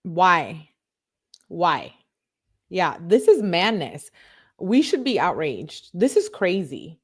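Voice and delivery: usual speaking voice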